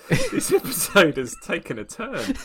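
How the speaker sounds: high-pitched, talking through laughter; real laughter. Real, not acted. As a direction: squeeze the words out while cracking up. Cracking up at the derailed show.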